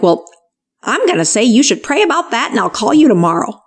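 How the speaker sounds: hammed-up Southern lady telling you to pray on it, quick; strong Southern accent. Church caller telling the doctor to pray on it, in a hammed-up Southern accent. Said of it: "she's got this really strong Southern accent".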